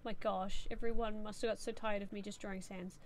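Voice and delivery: ordinary talk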